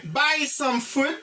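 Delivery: slow buy some food; slow model phrase. Shown as a demonstration. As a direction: repeat it slowly. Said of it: "Ti pre, ti pre".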